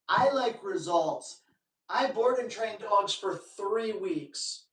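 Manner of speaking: ordinary talk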